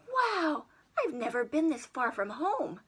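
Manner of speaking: put-on amazed child in space; awed boy. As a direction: gasp at the view. Jimmy amazed high above earth.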